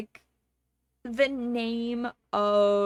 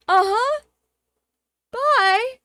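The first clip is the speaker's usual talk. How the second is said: high, louder, clipped goodbye; curt dismissal; 10 semitones higher, 9 dB louder, slower than usual. Retold or imitated. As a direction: get rid of them fast. Curtly dismissing the tourists.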